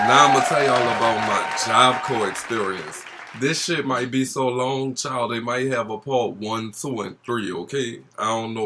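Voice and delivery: loud, fast gossipy hype; breathless gossipy storytime. Acted. Storytime intro continues.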